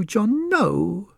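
high wife's voice, rising at the end; a wife's plea lifting into a question; pleading wife. Acted. Wife pleads again with husband, high rising cry. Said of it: "cried Missus Skint".

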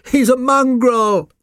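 high, loud, drawn-out pug denunciation; snooty little dog, loud and high; scornful pug. A narrator's reading. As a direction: cry it out slowly in high-pitched disgust. Pug scornfully denounces the newcomer.

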